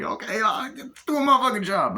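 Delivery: high-voiced grudging okay; actor impression. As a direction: give in reluctantly like a cartoon sidekick. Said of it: "doing the impressions of the, uh, actors".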